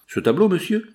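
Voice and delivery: high-pitched, indignant echo question; surprised shopkeeper. Acted. Shopkeeper answering a gentleman asking about her painting, surprised. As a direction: toss the question back, pitched well up.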